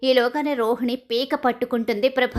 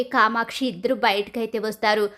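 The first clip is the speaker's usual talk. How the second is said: ordinary delivery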